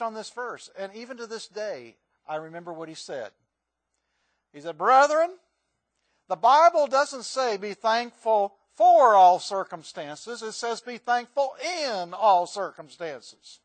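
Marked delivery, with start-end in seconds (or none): 4.8-13.6 put-on booming slow old-time preacher; Ira North preaching. Preacher imitating Ira North to congregation, booming slow. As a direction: boom it out slowly like an old pulpit preacher. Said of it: "not the best Ira North accent".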